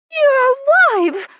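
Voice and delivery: very high, loud and slow delighted cry; excited countess. Acted. Countess exclaiming at Colin, high and thrilled. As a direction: gasp it out in giddy disbelief.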